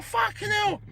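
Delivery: imitating his own stunned reaction, pitched high; a hammed-up gasp of disbelief; retold amazement at the sparring; mock shocked exclaim.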